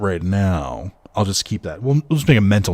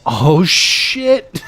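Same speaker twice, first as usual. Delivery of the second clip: surprised shout; 8 semitones higher, 6 dB louder than usual. Real, not acted. Sudden in-game surprise.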